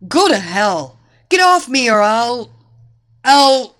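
loud, defiant outburst that breaks off; furious, faltering shout; angry sputtering. A narrator's reading. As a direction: sputter a threat and get stuck. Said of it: "the grappler sputtered".

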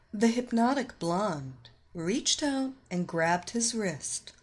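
ordinary delivery

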